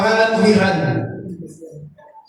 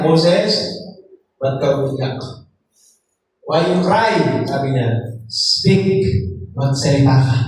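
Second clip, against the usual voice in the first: much lower, calm voice of the Lord; voice of God; 11 semitones lower, slower than usual. Acted. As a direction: drop low and speak as God answering Moses. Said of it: "Sabi ng Panginoon sa kanya". (mababang tinig ng Panginoon)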